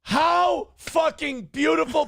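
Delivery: high gleeful exclamation; gleeful outburst. Real, not acted. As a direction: burst out with delight.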